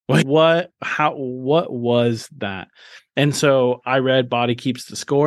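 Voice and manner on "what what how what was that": slow, dazed asking after crying in the car; baffled and shaken, each word drawn out; stunned disbelief. Retold or imitated. Shaken man asks himself about his sudden breakdown, dazed and slow. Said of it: "And I went".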